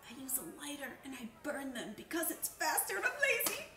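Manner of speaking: hurried sheepish confession; blurted confession. Pretend. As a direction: rush out the guilty secret, rising in pitch. Guilty secret blurted out.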